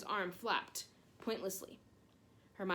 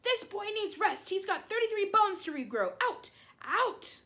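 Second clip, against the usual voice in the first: high, loud scolding shout; 12 semitones higher, 7 dB louder than usual. A narrator's reading. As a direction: throw the noisy boys out at full voice.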